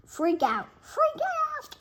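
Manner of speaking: climbing to a very high squeal; rising panic. Acted. The class panicking, rising to a high squeal. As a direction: build up to a squeaky freak-out.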